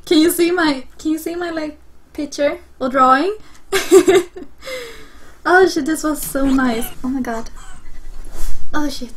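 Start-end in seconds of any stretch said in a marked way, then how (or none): 3.4-5.5 high, giggly burst of laughter; delighted laugh. Real, not acted. Player showing viewers their drawing, laughing with delight. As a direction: laugh helplessly at your own work. Amused at own cat drawing.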